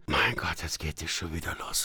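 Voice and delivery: low, quiet mock groan; imitated family exasperation. Guest imitates wife and son when he laughs, low weary mutter. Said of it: "Was kommt als Reaktion von den beiden?".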